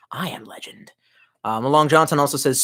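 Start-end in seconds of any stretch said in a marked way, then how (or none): 0.1-0.9 hushed movie-title whisper; breath-only dramatic hush; mock-dramatic whisper. Mock-grand self-naming.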